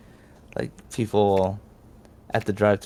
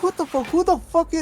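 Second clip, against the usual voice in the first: very high-pitched mock disbelief; incredulous outrage. Pranked worker leaning out the window, high incredulous outrage. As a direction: sputter it in a squeaky, outraged voice. Retold cousin demanding who is there.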